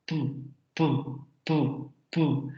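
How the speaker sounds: quiet "Pu" pops; "Pu" as soft plosive bursts; demoed p sound. Shown as a demonstration. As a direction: puff out "Pu" softly each time. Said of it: "liberando el aire de manera abrupta".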